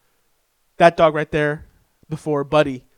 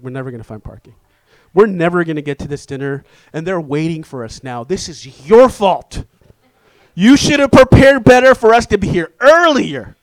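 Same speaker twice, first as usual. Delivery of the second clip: rising into an angry loud rant; blaming outburst; 8 dB louder than usual. Retold or imitated. Husband stuck in traffic blaming his wife, angry rant. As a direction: snap at the wife in blame. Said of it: "I'm blaming her now".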